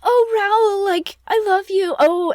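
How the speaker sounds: gushing mock-romantic Oh Raoul; mock swooning heroine. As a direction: play the dramatic teen in love, overdone. Christine swooning over Raoul.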